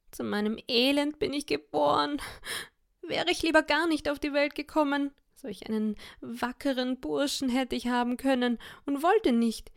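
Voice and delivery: high, wailing self-pity; wife pretending to cry to husband; fake weeping lament. A narrator's reading. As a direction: wail her misery, pitch up. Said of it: "fing sie an zu weinen".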